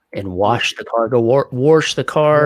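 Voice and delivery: "wash" said "warsh" with an added R, slow; grandparents' "warsh the car", drawn out; Utah R in wash. Retold or imitated. Mimicking grandparents saying "warsh". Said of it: "put R's in Washington".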